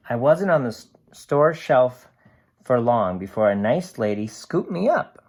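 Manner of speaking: lively storybook voice for a can's diary; cheerful picture book narration; reading to children. A narrator's reading. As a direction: read it bright and animated to a class. Reads picture book aloud.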